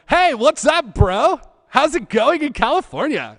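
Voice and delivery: high, loud surfer-bro voice; teasing bro greeting. Retold or imitated. Boss teasing him as a California bro, high and loud.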